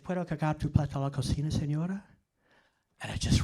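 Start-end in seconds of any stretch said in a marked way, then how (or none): 0.0-2.0 rattled-off phrase. Retold or imitated. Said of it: "and said".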